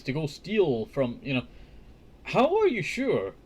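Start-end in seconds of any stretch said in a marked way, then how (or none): 2.2-3.3 mocking high voice.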